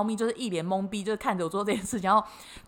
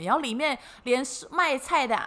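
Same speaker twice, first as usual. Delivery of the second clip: ordinary delivery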